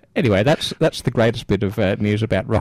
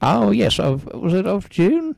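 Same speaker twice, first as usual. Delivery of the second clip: high surprised voice; 9 semitones higher than usual. Acted. Fake forgetting the date.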